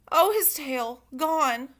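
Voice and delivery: ordinary delivery, nothing marked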